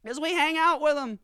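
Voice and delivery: high sarcastic voice voicing poor whites; high mocking rant. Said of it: "It's like".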